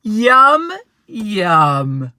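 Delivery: loud, savouring; greedy bear; yum yum. Acted. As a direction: smack your lips. Bear eating pie.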